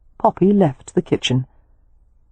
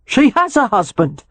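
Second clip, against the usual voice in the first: protesting; 8 semitones higher than usual. Acted. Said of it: "Jake protested".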